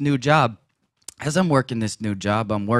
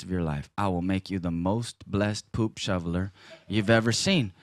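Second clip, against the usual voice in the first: gentle divine voice; 3 semitones lower, 6 dB quieter than usual. Retold or imitated. Voicing the Lord's reply.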